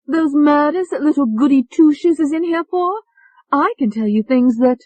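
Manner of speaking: put-on high, loud, pushy English accent; brash English accent. Tony demanding to get out, brash English accent. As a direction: cut him off, brash and English. Tony blurts about murders.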